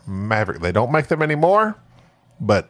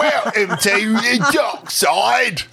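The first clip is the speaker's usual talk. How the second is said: put-on Aussie accent; 8 semitones higher, slower than usual. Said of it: "drinking Foster".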